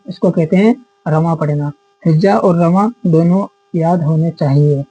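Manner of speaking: ordinary talk, nothing marked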